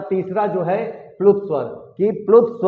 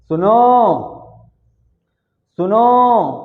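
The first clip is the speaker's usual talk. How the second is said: loud call with the end of सुनो stretched; raised, drawn-out summons; calling someone; 3 semitones higher, 4 dB louder, much slower than usual. Shown as a demonstration. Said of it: "हमने किसी को बुलाया".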